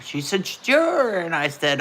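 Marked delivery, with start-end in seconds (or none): 0.5-1.2 high, drawn-out retold yes; eager agreement. Friend agrees to get online and talk, eager agreement.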